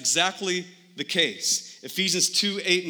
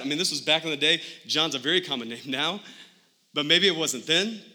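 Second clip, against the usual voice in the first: usual speaking voice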